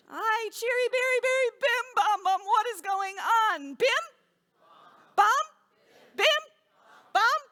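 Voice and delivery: high, loud flustered outburst; hammed-up exasperated wail; frantic confusion. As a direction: cry out in mounting bewilderment, then fire off questioning calls. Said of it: "she gets so confused".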